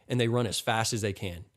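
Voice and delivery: ordinary delivery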